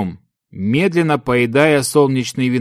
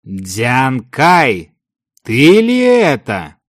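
rhetorical; 4 dB louder, much slower than usual. A narrator's reading. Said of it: "риторически спросил он".

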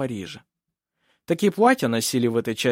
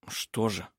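quiet, careful query; hushed prompting; 2 semitones lower, 7 dB quieter than usual. Acted. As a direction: ask it under your voice. Yves prompts her quietly.

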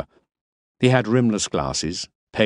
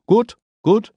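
Miller; 6 dB louder than usual. A narrator's reading. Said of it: "he said".

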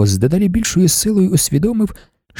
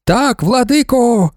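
high, slow fervent reply; women shouting; 8 semitones higher, slower than usual. A narrator's reading. Women answer their lord in a high, drawn-out shout. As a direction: shout back in devoted unison. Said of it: "закричали жінки".

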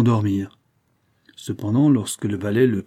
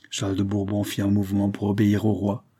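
ordinary delivery

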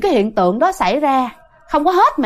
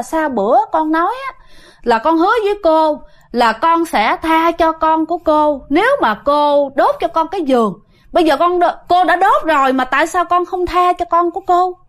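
higher pitched, demanding questions; mother challenging the ghost that broke its promise, insistent and higher; confronting the spirit; 5 semitones higher than usual. Retold or imitated. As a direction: press the ghost for answers, a mild mock indignation. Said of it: "mẹ chất vấn như vậy".

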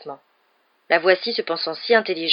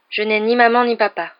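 sad; 4 semitones higher, 5 dB louder than usual. A narrator's reading. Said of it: "répondit tristement Harry".

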